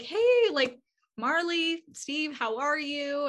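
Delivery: high, drawn-out friendly hello; delighted greeting. Retold or imitated. Gaga greeting fans backstage after the show, delighted greeting. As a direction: imitate a star happily spotting familiar fans.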